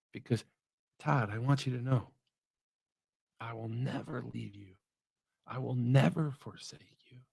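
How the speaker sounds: soft, low reassuring voice of God; tender hush. Acted. God speaking to Todd, soft tender reassurance. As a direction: reassure him gently, almost whispering.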